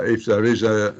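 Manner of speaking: ordinary talk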